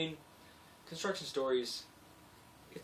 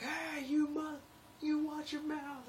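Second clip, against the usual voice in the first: high squeaky mock-scolding voice; mock scolding iron worker; 3 dB louder, slower than usual. Iron workers scolding him for his language, in a high mock voice. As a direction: squeal it like a fake offended tough guy. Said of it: "they're like".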